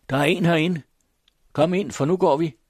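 high, loud, hurried shouting; loud call. Acted. The farm woman shouts to her mother before running off, loud and high. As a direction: call it out across the house. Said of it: "råbte hun højt".

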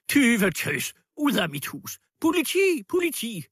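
very high, loud shriek; howling outrage. A narrator's reading. Landlady throwing out a supposed thief, howling. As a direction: screech it out like calling for the police. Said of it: "hylede fru Libeveksel".

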